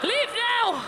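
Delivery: high mock-weepy plea; imitated distraught father; tearful dad. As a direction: send them away, hammed-up and tearful. Crying dad sends her away.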